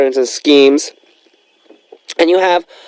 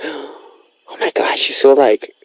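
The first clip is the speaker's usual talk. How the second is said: awed, breathless whisper, reenacted; starstruck whisper; 3 semitones higher, much slower than usual. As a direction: whisper it slowly like a stunned fan.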